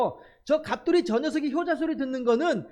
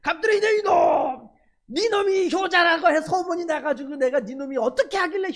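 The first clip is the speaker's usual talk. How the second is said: furious shout; 6 semitones higher, 4 dB louder than usual. Retold or imitated. Incensed neighbour storms at Gapdol in the yard, shouting. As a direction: roar the name like a furious neighbour scolding. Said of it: "더욱더 이제 열이 받는 거야".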